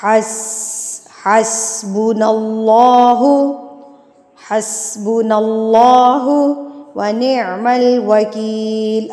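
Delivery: slow, drawn-out melodic tilawat; Quran recitation. Shown as a demonstration. Teacher recites the verse in tilawat style. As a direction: recite with long stretched vowels.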